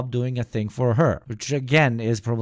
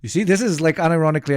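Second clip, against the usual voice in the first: much higher and louder, thrilled; excited outburst; 9 semitones higher, 6 dB louder, much faster than usual. Real, not acted. Host excitedly jumps in on the sandwich bit, high and loud. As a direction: burst in with delight at the point.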